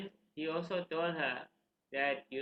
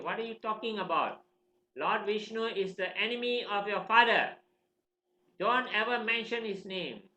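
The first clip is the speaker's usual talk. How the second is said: raised, forceful mock reprimand; alarmed scolding; 5 semitones higher, 5 dB louder than usual. Worried teachers warning the king's son, scolding. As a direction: scold in a higher storyteller's voice.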